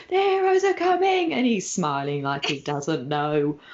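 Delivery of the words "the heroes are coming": high, loud alarmed cry; panicked shout. Retold or imitated. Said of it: "everyone's just going".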